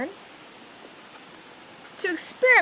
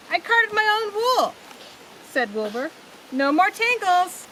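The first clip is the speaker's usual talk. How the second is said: excited boast; 8 semitones higher than usual. A narrator's reading. Wilbur boasting to his parents, high and excited. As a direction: show off to Ma and Pa.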